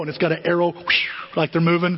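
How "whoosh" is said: quick airy whoosh. Retold or imitated. Describing a moving arrow logo, whoosh sound. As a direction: imitate something rushing past.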